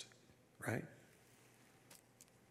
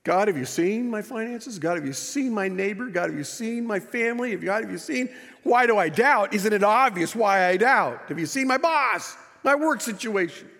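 acted exasperated pleading; 5 semitones higher than usual. Doubter to God about finances and boss, exasperated. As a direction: plead your case, piling up the evidence.

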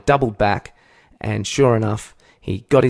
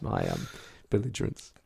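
normal talking voice

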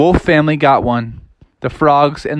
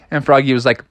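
usual speaking voice

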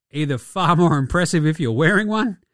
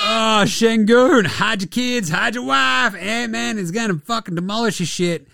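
hyped warning; 7 semitones higher than usual. Real, not acted. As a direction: sound the alarm for the victim. Hyping a dunk with a meme.

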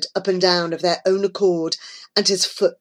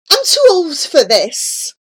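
high, loud, mildly winded protest; panting; 10 semitones higher, 6 dB louder than usual. A narrator's reading. Old man forced to dance, lightly panted protest. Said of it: "panted the old man".

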